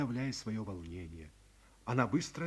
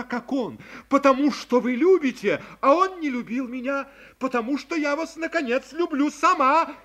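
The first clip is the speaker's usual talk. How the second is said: louder, higher rising declaration; impassioned young woman; 9 semitones higher, 14 dB louder than usual. A narrator's reading. Girl declaring love to her friend, rising passion. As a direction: build each reason higher.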